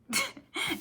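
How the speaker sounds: high laugh. Real, not acted. Laugh at April Fools.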